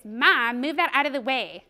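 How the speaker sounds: imitated snotty child's order, loud on the first word; bratty demand. Mother replaying her daughter's words back to her, bratty demand. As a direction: mimic a child rudely ordering mom. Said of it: "You said it like this".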